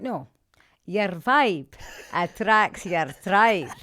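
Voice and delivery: loud, slow, laughing through the slogan; laughing mantra. Retold or imitated. Her inner voice answers her doubts, loud and laughing. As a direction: sing out the saying with a laugh.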